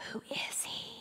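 breathless whisper of disbelief; whispered in shock; awed whisper. Acted. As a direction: hush it, stunned by what they saw. Disciples stunned after the storm.

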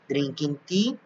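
ordinary talk

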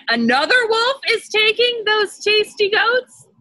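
can't believe another wolf got there; outraged old wolf. Acted. Old wolf hearing of a rival, high and shocked.

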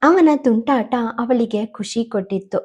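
usual speaking voice

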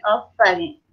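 ordinary delivery

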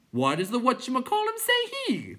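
high, loud clown question; clown creature voice. A narrator's reading. Clown asking the others why, high and loud. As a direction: ask it in a silly high voice.

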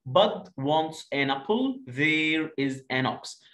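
put-on slow, clear reading for children; slow teacher read-aloud; slow model reading. Shown as a demonstration. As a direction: read it out slowly, word by word. Model rereading for pupils.

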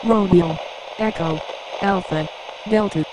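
high-pitched, processed radio voice spelling code words; intercepted code transmission. Acted. Decoded code from the submarines, high processed radio voice. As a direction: call out each code word like an intercepted signal.

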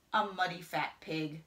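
ordinary delivery, nothing marked

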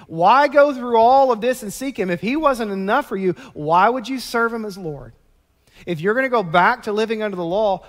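loud, rising preacher's appeal; fiery preaching. Acted. Preacher pleading with the church against the law, loud and fervent.

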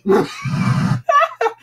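a high burst of shrieking laughter. Real, not acted. Gamer shrieking with laughter at a ghost.

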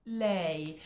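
ordinary delivery

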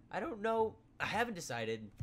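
high, loud startled outburst; blurting it out in surprise. Real, not acted. Sudden reaction to nosebleed.

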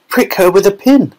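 high, urgent suggestion; a bystander blurts advice; another father. A narrator's reading. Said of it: "said one of the other fathers".

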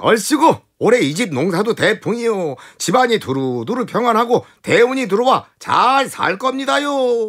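a cattle driver hailing the household's good fortune; cattle-driver's blessing. Retold or imitated. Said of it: "이 소몰이꾼이 나서가지고". (흥겨운 소몰이꾼 덕담)

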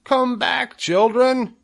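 put-on high, loud call after departing children; crying out. A narrator's reading. Wilbur begs the spiderlings to return, crying out. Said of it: "he cried".

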